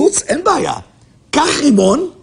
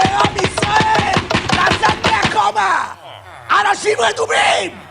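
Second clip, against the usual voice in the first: high-pitched rallying shout; rousing cry; 7 semitones higher than usual. Real, not acted. Rousing sleepy people to wake up, high shouted call.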